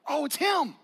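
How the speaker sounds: high, loud gasp of realization; sudden recognition. Retold or imitated. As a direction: realize all at once who it is. Disciples recognize Jesus.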